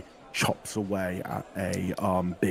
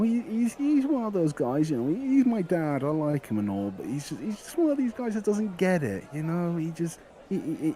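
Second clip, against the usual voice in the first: put-on higher rambling boyish voice; eager young lad; 7 semitones higher than usual. GM as the butcher's son pleading with the adventurers, eager higher boy voice. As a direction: talk fast and eager, trying to convince.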